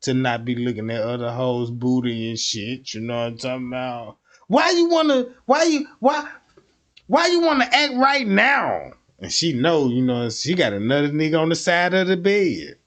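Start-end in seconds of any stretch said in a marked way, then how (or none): 4.5-8.9 mock outraged partner; high indignant demand. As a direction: pitch up high, indignant and pressing. Arguing with cheating partner.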